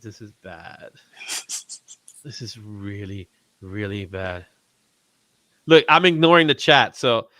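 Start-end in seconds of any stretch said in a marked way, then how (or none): 0.0-4.4 quiet dismay. Retold or imitated. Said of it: "I just went".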